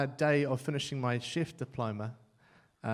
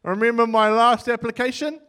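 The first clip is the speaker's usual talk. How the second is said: louder, higher taunting prayer; cheeky challenge to God; 7 semitones higher, 10 dB louder than usual. Retold or imitated. Student warning God about his lazy application, loud cheeky. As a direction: dare God with a grin. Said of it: "I'm like".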